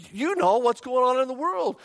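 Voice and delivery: very high, loud, worried voice; retold panic. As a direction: imitate a frantic caller. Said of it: "she was just panicked".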